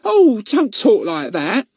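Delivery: worried, drawn-out protest in a high voice; fearful. A narrator's reading. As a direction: anxiously hush your cousin. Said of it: "said Miss Kay fearfully".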